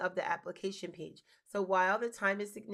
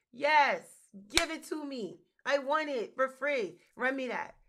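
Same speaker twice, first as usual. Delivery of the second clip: loud, higher, emphatic demand; hammed-up eagerness; 5 semitones higher, 6 dB louder, slower than usual. Host acts an applicant grabbing a free program, loud eagerness. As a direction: belt it out like a greedy, delighted applicant.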